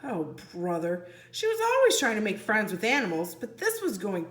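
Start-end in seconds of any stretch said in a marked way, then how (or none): none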